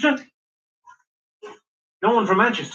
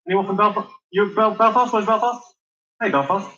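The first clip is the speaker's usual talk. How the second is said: ordinary talk, nothing marked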